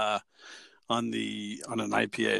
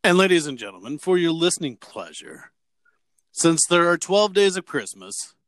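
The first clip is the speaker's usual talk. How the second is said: mock announcer; 4 semitones higher, 4 dB louder than usual. Co-host introduces a Christmas song to listeners, in a hammed-up announcer voice. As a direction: present the next song like a host on stage.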